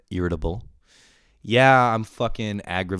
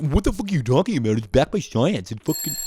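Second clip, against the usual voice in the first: mock outraged retort; 5 semitones higher than usual. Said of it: "they'll be like".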